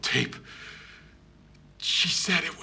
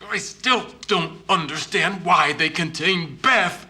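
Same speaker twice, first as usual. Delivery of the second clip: building from upset to a near-shout, higher and louder; rising anger; 6 semitones higher, 4 dB louder than usual. Acted. Widower demanding answers about his wife, building to a shout. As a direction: grief turning into outrage, voice climbing. Grieving husband protesting wife's containment.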